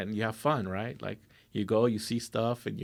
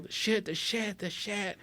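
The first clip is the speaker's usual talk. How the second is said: panicked dad; 10 semitones higher, 6 dB quieter than usual. Retold or imitated. Dad hitting wall, panicking.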